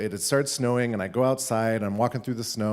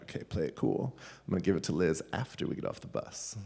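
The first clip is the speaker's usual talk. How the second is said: low, softened self-talk; playing it cool; 4 semitones lower, 9 dB quieter than usual. Boy spotting crush on bus, low quiet self-coaching. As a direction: keep it down, talking yourself into calm. Inner voice telling himself stay cool.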